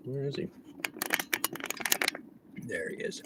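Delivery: hushed whisper while spotting a cop. Real, not acted. Driver whispering to viewers about a nearby cop. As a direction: whisper it, as if hiding.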